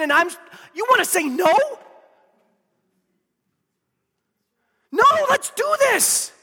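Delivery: loud, driving sermon voice; a preacher voicing Joshua's resolve to the church; full-force preaching. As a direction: preach it with all you've got.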